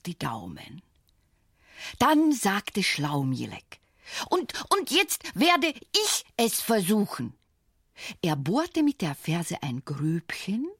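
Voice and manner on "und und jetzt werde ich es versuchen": hohe Zwergenstimme. A narrator's reading. Schlaumielek spricht.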